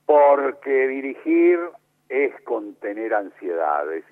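drawn out, each word weighted; slow emphatic maxim. Retold or imitated. Playwright recalling his maxim, slow and weighty. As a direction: lay down the rule slowly.

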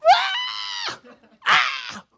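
very high, loud mock squeals; joyful shrieks. Preacher showing Jesus rejoicing, high loud shrieks. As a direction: squeal wildly with exaggerated joy. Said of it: "That's Jesus".

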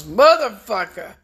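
high, loud mock outburst of "Motherfucker!"; mock yell. Imitating the enemy's wife yelling in the courtroom, loud and high. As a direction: shriek it like the fat wife in court. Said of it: "a fat wife that goes".

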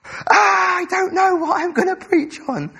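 high, loud mock despair; panicked wail. Pastor retelling panic before preparing sermon, high loud wail. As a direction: wail it like a stressed preacher. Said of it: "I was like".

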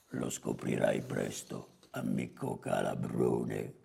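very low, soft creaky growl; rough, hushed boss pronouncement; hornet boss. Acted. Voices Calabrone answering Zanzarina.

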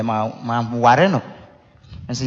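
ordinary talk, nothing marked

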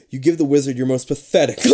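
usual speaking voice

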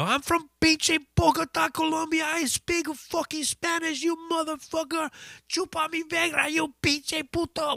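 high mock rant, held steady; imitating the singer cussing. Singer cussing out the heckler, high mock rant. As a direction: tell the heckler off, hammed up. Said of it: "cussing him out in Spanish".

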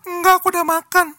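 high sugary girlfriend impression; high, coy girlish voice; cutesy girl voice. Friend's girlfriend voiced in story.

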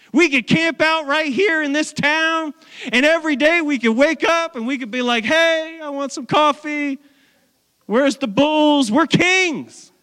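high, self-satisfied put-on voice; cocky would-be king. Apostles imagined coasting as gods, high mock-smug voice. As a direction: imitate someone lazily lording it over a town.

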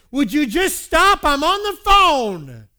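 high, very loud, drawn-out yell; exasperated yell. Retold or imitated. Husband to wife while on the phone, exasperated yell. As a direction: snap and yell it at full force. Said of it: "I shouldn't have yelled".

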